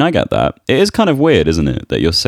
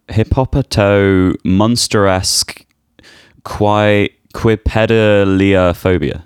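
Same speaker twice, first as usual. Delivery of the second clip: syllable by syllable, slow; slow careful sounding out; 3 semitones higher, slower than usual. Shown as a demonstration. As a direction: sound out a long word slowly. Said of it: "Now try and pronounce it".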